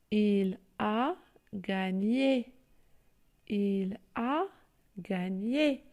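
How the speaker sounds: il a gagné, gagné drawn out, ga-gné; teacher dictating gagné; slow syllable reading. Shown as a demonstration. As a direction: read it out slowly for dictation. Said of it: "Je lis".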